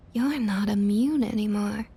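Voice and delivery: ordinary delivery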